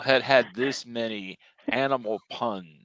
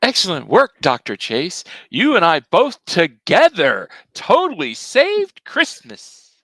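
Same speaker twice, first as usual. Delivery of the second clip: loud, higher, slow proclamation; triumphant hero; 4 semitones higher, 9 dB louder, slower than usual. Acted. Hero congratulating the doctor on saving Christmas, loud and triumphant. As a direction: boom out the victory like a cartoon hero.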